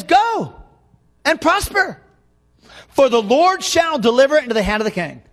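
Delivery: high, ringing preacher's declaration; straight prophetic proclamation. Acted. Micaiah answering the king as the verse reads, straight high proclamation. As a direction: proclaim it as if in earnest. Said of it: "he's not saying it the same way".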